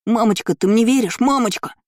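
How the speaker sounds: put-on high-pitched pleading child; sobbing plea. A narrator's reading. Boy begs his mother to believe him, sobbing. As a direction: whine desperately for belief. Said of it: "зарыдал Лайер".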